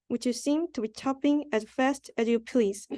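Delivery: plain reading voice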